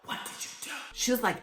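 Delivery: harsh stage whisper of an angry teacher; loud whisper. Retold or imitated. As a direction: hiss it out like a furious teacher mid-test. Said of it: "whispering, but she was loud as hell".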